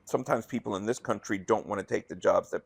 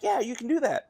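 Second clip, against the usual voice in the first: high mock agent voice. Voicing the support agent's reply.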